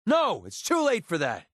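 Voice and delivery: high, sharp outburst on the first word; angry refusal. Acted. Refusing a partner who asks too late, angry and high. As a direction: cut them off angrily, pitch jumping up. Rejects a plea in quarrel.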